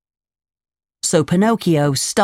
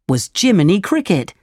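ordinary delivery, nothing marked

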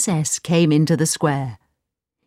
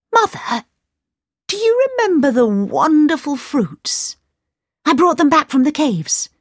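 very high, eager call to mother; a sudden idea bursting out; excited young Aladdin; 9 semitones higher than usual. Acted. Aladdin voiced by narrator.

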